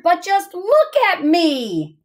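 high, drawn-out storybook wail; slow, hammed-up exclamation; dramatic dismay. A narrator's reading. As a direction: stretch it out high, as a dismayed child. Child's dismay in story read aloud.

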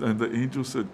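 usual speaking voice

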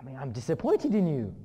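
loud, swooping parental reproach; mock disappointment. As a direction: let him down loudly, hamming it up.

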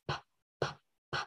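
bare "p" popped three times without voice; isolated "p", no vowel; voiceless p sound. Shown as a demonstration. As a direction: puff out each "p" on breath alone. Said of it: "without vibration".

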